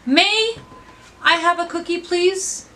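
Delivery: "May" lifted high and held apart; stress on May. Shown as a demonstration. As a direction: hit "May" high, then the rest of the question. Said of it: "change the vocal inflection for each word".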